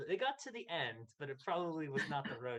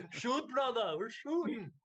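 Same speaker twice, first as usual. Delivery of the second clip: loud, much higher booming giant shout; Andre the Giant voice; 10 semitones higher, 7 dB louder, slower than usual. Retold or imitated. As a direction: bellow it like a wrestling giant. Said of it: "in that Andre voice".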